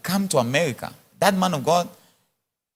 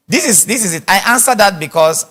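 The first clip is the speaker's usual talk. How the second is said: usual speaking voice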